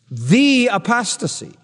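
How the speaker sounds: "The" stretched into a weighty "thee"; slow, emphatic hit on "The"; stress on THE. Shown as a demonstration. Preacher showing the article is definitive, stressed THE. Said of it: "that article is very definitive".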